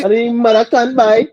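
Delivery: high mock Trinidadian cussing; Trini accent. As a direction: playfully mimic a Trinidadian.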